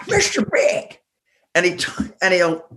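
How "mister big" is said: very high, squeaky caricature; mock Mister Big. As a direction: squeal the band name. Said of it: "So he goes".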